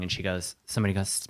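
usual speaking voice

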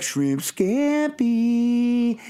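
high, very slow needling; goading sing-out with a long-held last word; teasing provocation. Pretend. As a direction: stretch it right out to wind him up. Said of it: "And so you'd be like".

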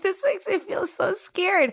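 high, slow put-on fright; mock terrified. Telling friends how scared she was, mock terrified. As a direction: ham up being scared of the cop. Retelling her scared reaction, being pulled over.

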